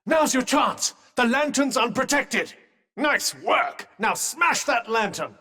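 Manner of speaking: high, rousing battle encouragement; urgent cheering on. Acted. Companion spurring Frey on at the unprotected lantern, rousing. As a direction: urge them to seize the opening.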